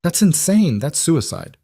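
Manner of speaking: high-pitched protest, easing down after; incredulous outburst that drops off; alarmed disbelief. A narrator's reading. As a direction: start high, aghast at the plan. Clara balks at the plan.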